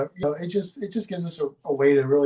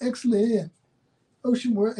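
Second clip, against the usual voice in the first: Wayne's World voice; 7 semitones higher than usual. Retold or imitated. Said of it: "Wayne from Wayne's World".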